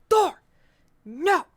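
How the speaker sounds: high, loud sharp "Thor, no!"; shouting at the cat. Retold or imitated. Scolding her cat Thor from bed, a loud high shout. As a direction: yell the cat's name to stop him.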